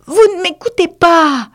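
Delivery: very high, loud scolding mouse; piercing, severe telling-off; stern reproach. A narrator's reading. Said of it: "d'un ton de voix sévère".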